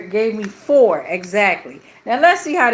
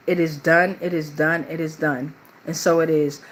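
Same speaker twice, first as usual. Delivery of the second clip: lower, steady prayer recitation; spoken prayer; 4 semitones lower, 3 dB quieter than usual. Real, not acted. Host closes by rebuking spells for viewers, spoken prayer. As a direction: recite it like an affirmation, in an even low voice.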